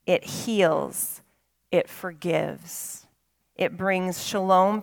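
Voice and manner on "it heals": ordinary talk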